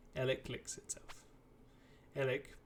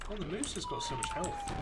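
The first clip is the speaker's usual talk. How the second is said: ordinary talk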